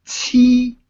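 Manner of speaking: "Qi" with a strong puff, held level; forced-air consonant. Shown as a demonstration. Teacher demonstrating tongue placement, "Qi" with forced air. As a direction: push air sharply out at the start of "Qi". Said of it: "block the air and force the air out".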